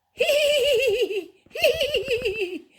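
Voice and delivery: very high, rapid pulsing squeal; pig's squealing hee-hee. Acted. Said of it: "Pig went up to Dog and went".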